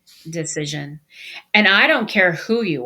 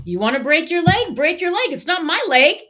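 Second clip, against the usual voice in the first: higher, louder mock of a blunt mother; mother's blunt saying; 10 semitones higher, 5 dB louder than usual. Sicilian mom telling her son to take risks, mock blunt. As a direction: toss it off like a no-nonsense mom. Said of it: "she would have this saying".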